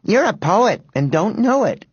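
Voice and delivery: put-on high girlish teasing; taunting. A narrator's reading. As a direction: tease smugly with a rhyme. Girl taunts the boy.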